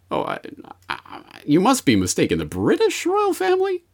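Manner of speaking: high, affronted upper-class gasp; mock posh indignation. Host mocking a royal loyalist, high posh outrage. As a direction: play a scandalised toff.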